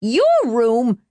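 high, outraged shriek; high-pitched indignant screech. A narrator's reading. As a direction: shriek it back in disbelief. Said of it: "she shrieked down".

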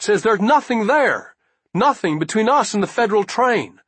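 put-on high, breathless young soldier voice; eager young scout. A narrator's reading. Young scout delivers urgent message.

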